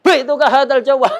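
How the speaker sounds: loud, high, booming sales call; a hawker calling out to sell his phone; loud neighbour seller. Retold or imitated. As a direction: shout it across the market stall. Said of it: "Misal ada orang sampingnya".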